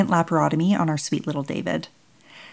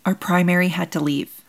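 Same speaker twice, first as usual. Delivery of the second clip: usual speaking voice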